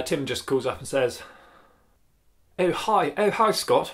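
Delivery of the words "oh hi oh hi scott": put-on a barely-there stab at Tim's accent, a little higher; Tim accent attempt. Vlogger half-heartedly imitating Tim answering a call, lightly higher. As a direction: try Tim's accent and give up halfway. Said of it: "can't do a Tim accent".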